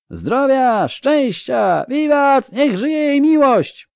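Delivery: very high, loud acclamation; shouted hurrahs at the top of the range; cheering toast. Acted. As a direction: cheer the duchess like a whole hall. Banquet guests cheering a toast.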